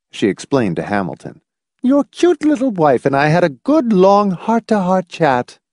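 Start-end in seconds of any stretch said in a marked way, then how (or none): 1.8-5.6 high-pitched, effusive matron; joyous gushing. A narrator's reading. Visiting matron explaining to Hamilton, joyous gushing. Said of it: "informed him joyously".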